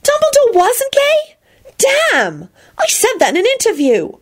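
very high, startled question, then grumbling; dismayed. A narrator's reading. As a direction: react with comic shock. Dumbledore impersonator dismayed at news.

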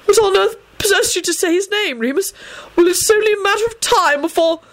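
high exasperated scolding; exasperated old headmaster; strident scolding. A narrator's reading. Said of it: "Dumbledore burst out stridently".

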